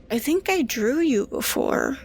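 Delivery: put-on high, puzzled girl's question; wondering girl. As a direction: wonder aloud, half to herself. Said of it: "she went".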